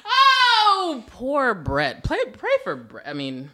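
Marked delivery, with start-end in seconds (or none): 0.1-1.0 high, loud drawn-out "oh"; mock-pitying wail. Host pities her new friend Brett, mock wail. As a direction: wail in exaggerated sympathy.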